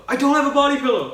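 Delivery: fast, high, loud blurted denial; defensive outburst. Retold or imitated. Imagined Kevin denying too much, fast high outburst. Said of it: "Or maybe I could be like".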